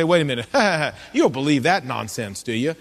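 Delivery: lightly acted skeptic, laughing off the idea; mock scoffing laugh. Voicing a scoffing doubter's objection.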